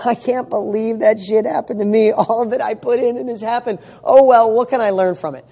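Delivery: upbeat acceptance. Retold or imitated. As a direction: shrug it off, brightly ready to learn. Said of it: "Or you can go".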